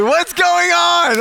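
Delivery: high, drawn-out mock wail; exasperated outcry. Preacher relives his dismay at failed prayers, exasperated outcry. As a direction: cry it out in loud, hammed-up disbelief. Exasperated retold reaction to no healing.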